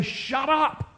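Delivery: high sneering rebuke; mock rebuke. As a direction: disciples waving a blind beggar off in a mocking high voice. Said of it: "and they say".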